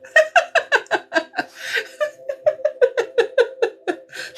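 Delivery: long high laugh; kind gentle laugh. Real, not acted. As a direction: laugh in happy disbelief. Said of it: "laughs at synchronicity".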